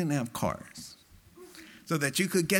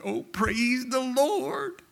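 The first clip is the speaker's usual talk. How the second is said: mock jubilant praise; 8 semitones higher than usual. Preacher mimicking worshippers leaving the halls, high mock praise. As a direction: play a gushing believer, overdone. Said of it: "and going".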